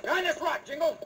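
high, urgent shouted order; urgent warning shout. Acted. Bill to Jingles under ambush, urgent shout. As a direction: yell it over the gunfire. Cowboy warns partner under gunfire.

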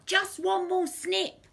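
high, insistent villain voice; stubborn thief, high in pitch; Len the crook. A narrator's reading. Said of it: "But Len replied".

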